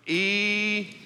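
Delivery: stretched-out southern "Eternity"; slow Knoxville preacher drawl on "Eternity". Shown as a demonstration. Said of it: "let's try it in your best southern".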